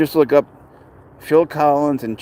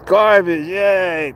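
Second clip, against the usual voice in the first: high, loud excited cheer; playful cheer; 6 semitones higher, 4 dB louder, much slower than usual. Pretend. Streamer cheers for Pouncer's beloved garbage, playful cheer. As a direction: hoot it with drawn-out glee.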